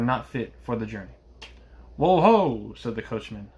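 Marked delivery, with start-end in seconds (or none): 2.0-2.7 raised, higher coachman's halt call. A narrator's reading. Coachman halting his horses, calling out. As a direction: call out loud to pull up horses.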